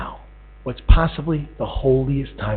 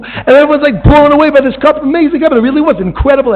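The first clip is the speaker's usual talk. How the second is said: high, loud storytelling drive; fervent preacher climax; preaching at full force; 10 semitones higher, 5 dB louder than usual. Acted. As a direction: sell the grand unveiling.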